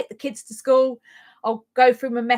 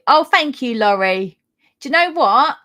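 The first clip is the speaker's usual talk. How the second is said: normal talking voice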